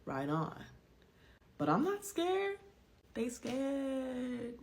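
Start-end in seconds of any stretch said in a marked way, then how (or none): 1.6-4.6 high-pitched squeaky defiance; mock bravado. Defying her fear of leaving, high mock bravado. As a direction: play it cocky in a high, silly voice.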